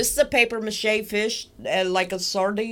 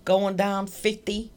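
ordinary talk, nothing marked

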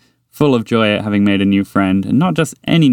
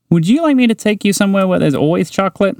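higher, eager little mouse voice; higher mouse, keen and friendly; 11 semitones higher than usual. Acted. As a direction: tempt a new friend with chocolate. Mouse character offering.

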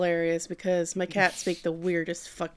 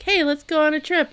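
higher, bright mock-parent invite; mock cheerful parents; 8 semitones higher than usual. Parents to Subaru on school break, higher chipper mock invite. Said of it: "his parents are like".

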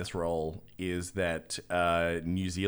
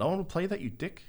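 put-on higher, cheeky Kiwi kid voice; Kiwi accent; 9 semitones higher, 5 dB quieter, faster than usual. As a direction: sass the developers like a Kiwi teen. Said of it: "a sixteen-year-old New Zealand kid ... just going".

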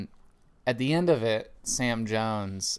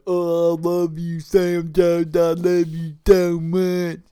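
higher, louder, pleading mock declaration, slowing at the end; lovesick suitor; 5 semitones higher, 11 dB louder, slower than usual. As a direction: swoon over Sam Jones in a put-on voice. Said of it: "he's like".

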